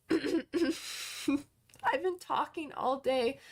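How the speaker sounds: slow, hoarse from talking all day; worn voice after a long day of talking. Real, not acted. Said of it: "I'm losing my voice".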